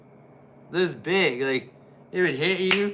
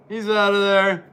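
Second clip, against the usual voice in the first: excited call; 6 semitones higher, 7 dB louder than usual. Real, not acted. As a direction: shout the elimination like a commentator. Calling an elimination in commentary.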